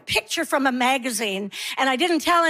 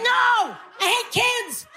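high exasperated shout; outburst at kids; 9 semitones higher than usual. Acted. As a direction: shout back at the chant, pitched way up. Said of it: "And I'm like".

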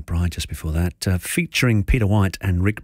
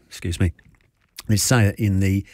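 ordinary talk

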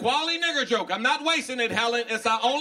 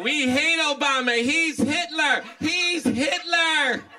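higher, slow drawn-out chant, building; mock fired-up ranting; 5 semitones higher, 3 dB louder, much slower than usual. Comic mocks fired-up Obama haters, higher drawn-out outcry. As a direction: imitate an overheated protester, stretching every word further. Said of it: "way too fired up".

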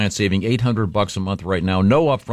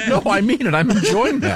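laughing; 13 semitones higher than usual. Real, not acted. Host cracking up at jokes.

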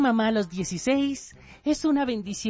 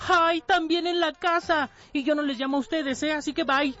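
mamá desafiante; 5 semitones higher than usual. Acted. Mamá responde al colegio.

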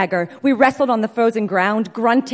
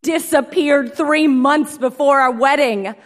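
high, loud, upset outburst; upset shout; 5 semitones higher, 5 dB louder than usual. Retold or imitated. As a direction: blurt it out, hurt and loud. Jilted groom confronts returned bride.

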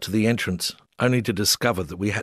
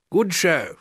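much higher, plummy English squire voice; British gentleman stepping in, posh squire voice, much higher; Shropshire squire; 8 semitones higher than usual. Retold or imitated. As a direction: greet like a fox-hunting English squire. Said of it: "sounding like a squire".

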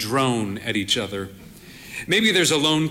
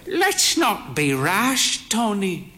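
put-on higher, slow voice of a proud frail old lady; frail old mother; 6 semitones higher, slower than usual. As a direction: play the bird-faced old mother, cautious. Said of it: "she said".

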